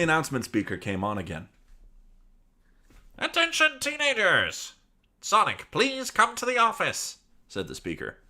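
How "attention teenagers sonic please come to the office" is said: very high, loud intercom call; announcer voice. Acted. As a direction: page him like a school PA. Announcement speaker again.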